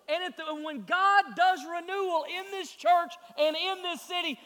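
rousing sermon delivery; impassioned preaching. Real, not acted. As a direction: preach it urgently to the whole congregation. Preacher at full force.

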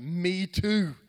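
loud, high, drawn-out agreement; hammed-up mock enthusiasm; faked excitement. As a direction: overplay being just as thrilled. Fakes sharing her excitement.

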